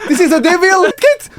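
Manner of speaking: very high, shouted mock Indian accent. Shown as a demonstration. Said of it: "avec un accent indien". (accent indien caricatural, hurlé dans l'aigu)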